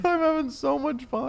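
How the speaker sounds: giggling through it, very high; laughing. Pretend. Repeating the Lane line while cracking up. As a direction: laugh out the words in delight.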